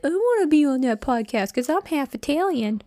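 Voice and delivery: high-pitched mock mom voice; imitated mother. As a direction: mimic an eager mother, pitched well up.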